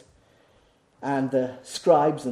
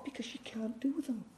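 whisper; 9 semitones higher, 16 dB quieter than usual. Acted. Sudden drop after loud preaching.

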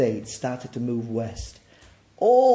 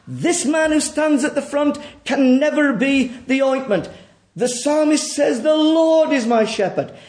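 raised, ringing sermon voice; forceful preaching; 11 semitones higher, 9 dB louder than usual. Acted. Preacher pressing the congregation to admit their sin, forceful. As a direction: press the hard truth on the congregation.

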